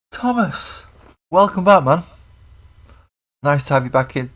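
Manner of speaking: high, loud, happy welcome; excited greeting. Real, not acted. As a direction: call out the welcome brightly. Greeting a returning viewer.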